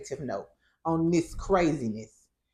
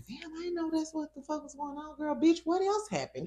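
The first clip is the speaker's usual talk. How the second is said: put-on higher, hushed gossiping voice of an older lady; gossipy Miss Nell; 9 semitones higher, 7 dB quieter than usual. Scene: imitating Miss Nell hungry for gossip, high and hushed. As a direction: lean in greedy for the tea. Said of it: "Miss Nell was like".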